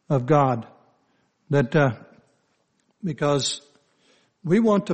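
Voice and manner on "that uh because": ordinary talk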